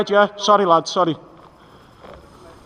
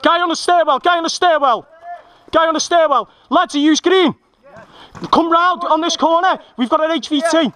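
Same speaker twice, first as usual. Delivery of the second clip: high urgent orders; shouted callouts; 7 semitones higher than usual. Real, not acted. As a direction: bark it across the room. Calling orders mid firefight.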